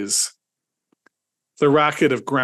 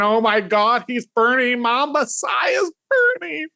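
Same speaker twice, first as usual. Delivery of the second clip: rising high panicked shout; hysterical grief; 11 semitones higher than usual. Acted. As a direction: wail it in mock horror. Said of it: "dramatic display of grief, horror".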